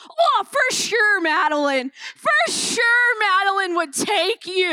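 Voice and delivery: mock confident crowd. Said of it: "and I quote".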